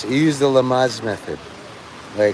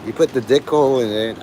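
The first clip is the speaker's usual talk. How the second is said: ordinary talk, nothing marked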